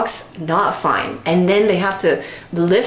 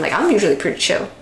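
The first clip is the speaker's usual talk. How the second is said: normal talking voice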